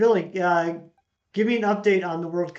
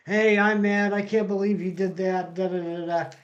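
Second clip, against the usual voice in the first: mock angry rant; 3 semitones higher, 4 dB louder than usual. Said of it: "in your face, like".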